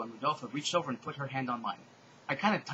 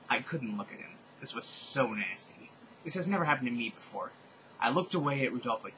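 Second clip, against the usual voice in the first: plain reading voice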